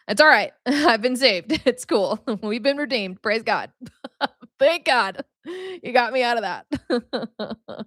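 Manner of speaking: real giggles between phrases; laughing. Real, not acted. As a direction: laugh through it with relief. Laughing over being saved.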